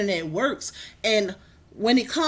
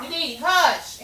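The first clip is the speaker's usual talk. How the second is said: scolding; 5 semitones higher than usual. Real, not acted. Shushes D while cooking.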